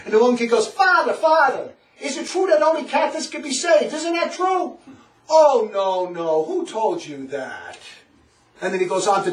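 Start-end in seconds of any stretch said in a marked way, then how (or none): 0.7-4.8 high-pitched imitation of an earnest kid; questioning child. Catechism pupil asking the priest, imitated high questioning. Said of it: "these young kids are being taught". 5.3-7.8 imitated dismissive priest. Priest correcting a young pupil, slow imitated denial. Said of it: "being taught the catechism by this priest".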